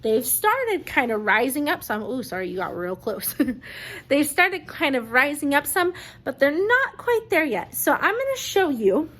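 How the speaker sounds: ordinary delivery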